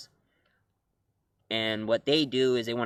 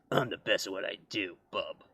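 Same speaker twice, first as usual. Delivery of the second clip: low, softer Wolverine growl; a lowered tough-guy voice; gruff Wolverine; 4 semitones lower, 6 dB quieter than usual. Retold or imitated. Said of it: "he always sounds like".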